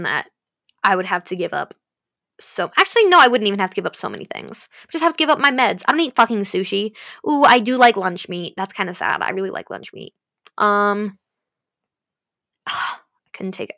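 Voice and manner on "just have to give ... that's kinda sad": ordinary delivery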